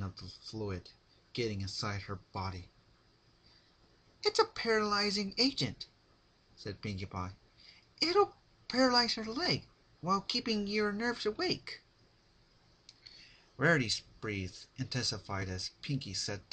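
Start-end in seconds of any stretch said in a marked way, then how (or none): 4.2-5.9 high, sing-song sweet menace; bright cheery threat, voiced high; Pinkie Pie voice. Narrator gives Pinkie a high sweet creepy voice. 8.0-11.8 high, sing-song sweet menace; bright cheery threat, voiced high; Pinkie Pie voice. Narrator gives Pinkie a high sweet creepy voice.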